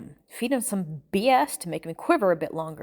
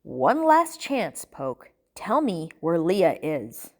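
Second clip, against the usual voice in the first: very slow, deliberate threat; menacing, drawn-out ultimatum; final warning; 4 semitones lower, slower than usual. Acted. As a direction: give a last warning, dropping low on the name.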